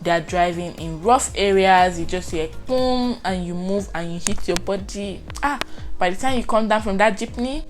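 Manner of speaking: usual speaking voice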